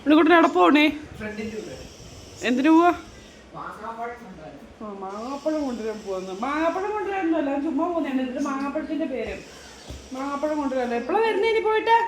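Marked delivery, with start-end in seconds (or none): 0.0-2.9 loud and higher, calling across the house. Real, not acted. Mother calls loudly to her son who is leaving. 11.0-12.0 calling out. Real, not acted. Mother calls to leaving son.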